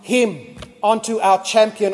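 normal talking voice

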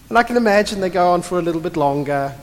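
normal talking voice

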